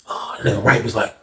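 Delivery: usual speaking voice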